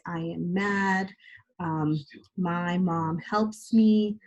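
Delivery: put-on slow, clear model sentence for young writers; slow example sentence. Shown as a demonstration. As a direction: read the example slowly so kids can copy it. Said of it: "So like".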